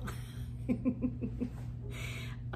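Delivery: quiet, airy little laugh; soft chuckle. Real, not acted. Winding down after joking about being stuck, soft chuckle. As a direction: let out a hushed chuckle.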